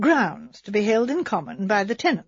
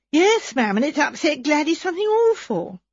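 higher, hurried protesting maid; flustered maid; 6 semitones higher than usual. Acted. Maid Edna assuring Miss Marple her cousin is innocent, higher and flustered. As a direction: rush to defend her cousin.

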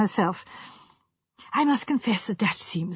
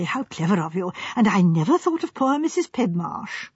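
ordinary delivery, nothing marked

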